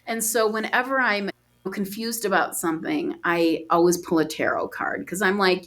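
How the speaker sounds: usual speaking voice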